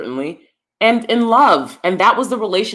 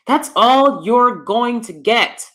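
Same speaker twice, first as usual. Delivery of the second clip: slow it right down, every word separate and higher; slow, emphatic restatement; 4 semitones higher, slower than usual. Real, not acted. Vlogger driving home the honest truth to ladies, slow and emphatic. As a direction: spell out "going to get" in full, word by word.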